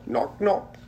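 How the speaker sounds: high knocking sound; door knock. A narrator's reading. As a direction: rap on a door. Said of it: "like a hand on a door".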